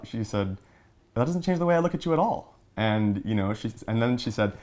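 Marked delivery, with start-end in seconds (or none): 1.1-2.6 mom's reply in a much higher, faster voice; mock-mother voice, high and quick; imitating mom. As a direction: lift the voice way up to quote a reassuring mother. Mom reassuring son after coming out.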